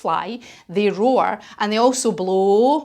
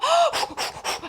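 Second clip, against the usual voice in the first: gasp; 4 dB quieter than usual. Acted. Wingtip catches fire.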